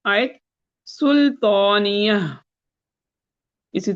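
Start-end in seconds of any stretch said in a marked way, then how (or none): 0.9-2.1 سلطانیہ spoken slowly with the final ہ held silent; slow teaching demo of سلطانیہ; slow, drawn out. Shown as a demonstration. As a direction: draw out سلطانیہ and close on a stopped ہ. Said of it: "الحاقہ کے انتیسویں آیت".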